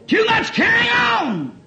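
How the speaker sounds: loud, high, stretched cry; preacher's shout. Real, not acted. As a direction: cry out the moral to the congregation. Preacher drives home his moral.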